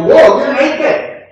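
slow, high and loud mock gasp of shock; drawn-out comic whoa; shocked discovery. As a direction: play Adam and Eve suddenly seeing they're naked, hammed up. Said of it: "they look at each other and go".

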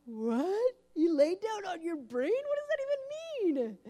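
high, loud incredulous yelp; can't believe what you just heard; baffled shock. Retold or imitated. Mother reacting to her daughter's strange dream, incredulous and loud.

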